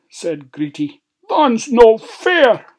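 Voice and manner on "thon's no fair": high, loud, drawn-out wail; slow, petulant dwarf complaint; whining protest. Acted. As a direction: wail it like a thwarted child. Greety whines at the unfairness.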